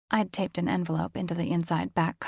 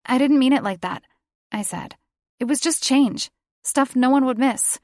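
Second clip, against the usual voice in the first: higher, louder, pleading; frightened plea; 7 semitones higher, 7 dB louder than usual. A narrator's reading. Daughter pleads with furious father, high and scared. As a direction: beg him to understand.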